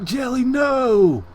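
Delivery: high, loud, slow mock wail; drawn-out wail. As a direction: wail it out long in dramatic despair. Retold cry at trapped cat.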